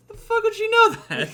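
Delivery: baffled and amused; laughing disbelief. Retold or imitated. Own confused reaction retold.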